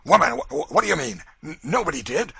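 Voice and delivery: a man badly shaken, stuttering; frightened stammer. A narrator's reading. Legree, frightened by Cassie's cold hand, stammers at her. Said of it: "he sprung back with an oath".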